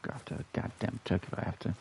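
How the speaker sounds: quick hushed grumble; fast whispered mutter. Real, not acted. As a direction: rush it under your breath.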